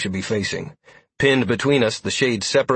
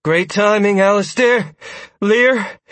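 breathless call; 10 semitones higher, 5 dB louder, slower than usual. A narrator's reading. Sierra greeting rescuers.

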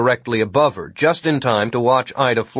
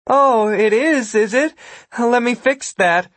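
taunting rival; 12 semitones higher, 4 dB louder than usual. A narrator's reading. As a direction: needle a trapped rival in a high voice. Ida taunts the boxed-in Gwen.

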